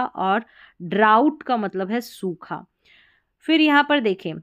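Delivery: normal talking voice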